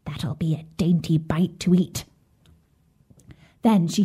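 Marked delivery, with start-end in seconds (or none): 0.0-2.0 low, whispery witch mutter; muttering to herself. A narrator's reading. Witch eyes the sleeping children, whispery mutter to herself. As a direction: mutter it under your breath, relishing it. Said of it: "she muttered to herself".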